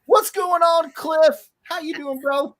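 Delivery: high, loud, excited hello; big cheery shout-out; hyped greeting. Real, not acted. Greeting a chat viewer.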